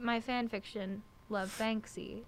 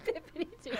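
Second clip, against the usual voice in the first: high, fast, giggly mock British; bad British accent; 12 semitones higher, 5 dB quieter, faster than usual. [name] repeating her silly phrase, laughing mock British accent. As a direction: squeak it out laughing in fake Brit. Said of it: "That's enough of bad British accents".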